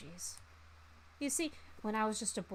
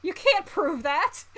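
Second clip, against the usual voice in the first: loud, high and slow denial; a hammed-up high, loud protest; defensive yell; 8 semitones higher, 9 dB louder, slower than usual. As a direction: shout it like a guilty man. Accused of killing a guy.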